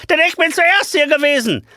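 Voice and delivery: outshouting. Acted. The impostor outshouts the real teacher before the inspector. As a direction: shout him down. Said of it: "überschreit ihn der Unechte".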